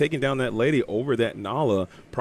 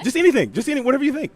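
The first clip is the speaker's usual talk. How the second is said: higher and louder, coaxing her to try; pushy cheerful encouragement; eager urging; 12 semitones higher, 4 dB louder, faster than usual. Real, not acted. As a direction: egg her on excitedly. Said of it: "urging her to try".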